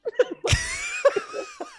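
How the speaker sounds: high shrieking burst of laughter. Real, not acted. Cracking up at the niece's answer, shrieking laugh.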